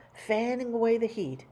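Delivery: ordinary delivery, nothing marked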